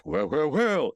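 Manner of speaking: a light [name] croon, barely voiced; retelling Jonathan Winters doing [name], lightly crooned; [name] impression. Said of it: "he does [name]".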